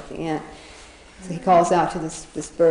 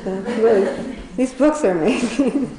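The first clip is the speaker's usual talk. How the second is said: real laughter bubbling through higher words; amused laughing; 5 semitones higher, 3 dB louder than usual. Real, not acted. Amused aside on these amazing books, laughing. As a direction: laugh as you say it.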